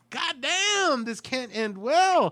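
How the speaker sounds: high, slow dread. Retold or imitated. Viewer recalls a tense film scene, high, slow dread. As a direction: brace for the worst.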